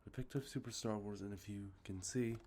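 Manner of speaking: normal talking voice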